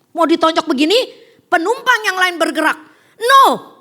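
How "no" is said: very high, loud cry of no; shouted no. Retold or imitated. Passengers stop the young man, loud high shout. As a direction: yell it sharp and high to stop the punch. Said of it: "dia bilang gitu".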